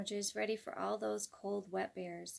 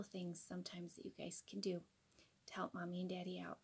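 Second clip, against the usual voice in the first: whispering; 4 semitones lower, 10 dB quieter than usual. Real, not acted. Aside to children.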